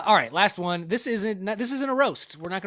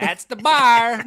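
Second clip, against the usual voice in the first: put-on slow, drawn-out Michigan accent, much louder; 10 semitones higher, 15 dB louder, much slower than usual. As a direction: mimic the lady's Michigan accent, loud and slow. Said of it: "that accent".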